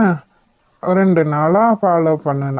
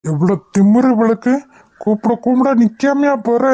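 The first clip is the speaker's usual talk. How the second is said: higher, indignant yell; angry call; 3 semitones higher than usual. Acted. Stalker scolding the woman who won't stop, angry higher call. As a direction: scold her for not stopping. Stranger yells at woman ignoring him.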